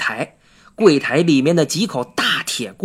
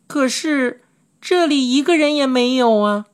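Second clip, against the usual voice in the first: put-on puzzled little girl, higher voice; 7 semitones higher than usual. A narrator's reading. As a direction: voice a child's doubt, pitched up high. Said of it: "千寻疑问地说道". (小女孩高声地疑惑发问)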